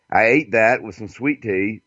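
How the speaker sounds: ordinary talk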